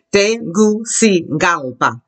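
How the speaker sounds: "Tegucigalpa" very slowly, syllable by syllable; slow modelling. Shown as a demonstration. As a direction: break it up as te-goo-see-GAL-pa for "Tegucigalpa". Models a hard name slowly.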